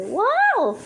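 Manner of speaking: amazed at the bird's beauty; excited wow. Real, not acted. Admiring the bird.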